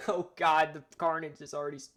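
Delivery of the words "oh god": a loud, startled exclamation; startled oh God. Real, not acted. Reacting to the simulation's carnage, startled outcry. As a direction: blurt it out, caught off guard.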